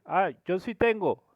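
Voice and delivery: high, loud answer from below; calling back. Retold or imitated. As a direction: call up cheerfully to a coworker. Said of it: "el de aquí abajo dice". (respuesta alta y fuerte desde abajo)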